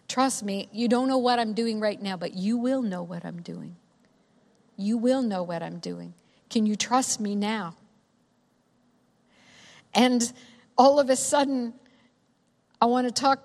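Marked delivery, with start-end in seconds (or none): 0.1-7.8 low, softened, reassuring voice; gentle pleading. Acted. Voicing Jesus gently asking for trust.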